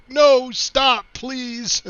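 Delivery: high pleading mock protest; friend begging him to stop. Bob begging the streamer to drop his accent, mock pleading. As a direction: beg him to quit the accent, half-laughing. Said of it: "and he's just like".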